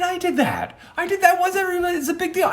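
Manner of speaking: very high, squealed protest; indignant outburst. Retold or imitated. Storyteller protesting someone else's viral fame, indignant. Acts out jealous outburst at video.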